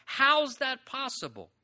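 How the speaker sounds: usual speaking voice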